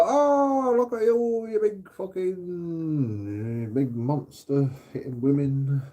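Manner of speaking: high mock outrage that fades; mocking onlooker. Bystanders scold a man for hitting back, high mock outrage. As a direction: gasp it in fake scandalised disgust. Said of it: "then you go".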